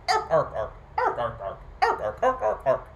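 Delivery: harsh repeated bird squawks; raucous blue jay imitation; obnoxious blue jay squawk. A narrator's reading. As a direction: squawk in pestering bursts of three. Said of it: "being an obnoxious bird".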